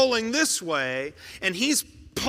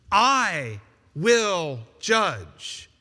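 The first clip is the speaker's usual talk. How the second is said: slow solemn decree; much slower than usual. Retold or imitated. God's voice, slow declaration.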